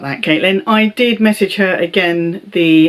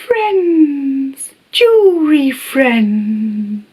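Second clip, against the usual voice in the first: high, slow, cooing friends; Inbetweeners catchphrase impression; 7 semitones higher, much slower than usual. Her Instagram comment on the giveaway, in a high drawn-out coo. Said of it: "I went".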